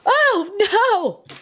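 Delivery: high, mock-alarmed oh no; mom's alarmed cry. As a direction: imitate her mother panicking at the fight. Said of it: "my mom is just like".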